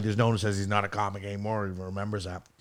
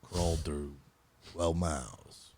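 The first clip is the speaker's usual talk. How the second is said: low slow narrator voice; deep soft movie narration; Morgan Freeman impression; 7 semitones lower, 11 dB quieter, slower than usual. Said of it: "Did you do a Morgan Freeman impression".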